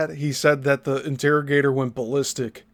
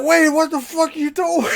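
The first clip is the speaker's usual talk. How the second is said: shocked shout; 13 semitones higher, 3 dB louder than usual. Retold or imitated. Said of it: "everyone's like".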